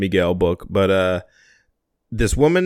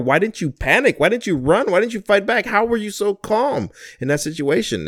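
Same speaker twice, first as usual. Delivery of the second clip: higher, incredulous string of questions; retold alarmed passenger; 10 semitones higher than usual. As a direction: imitate the shaken passenger demanding answers. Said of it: "The passenger guy is absolutely frightened".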